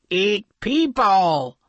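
slow, strange monster voice; sea monster voice. Acted. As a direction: rumble it like a hungry sea monster. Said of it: "said the sea monster".

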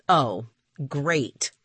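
mild sarcastic snap; snapped. A narrator's reading. Boy watches the fly land on a rooftop, snapped. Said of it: "I snapped".

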